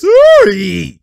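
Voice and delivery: loud, drawn-out "ooh"; excited whoop. Real, not acted. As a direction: whoop at a great play. Excited reaction to a play.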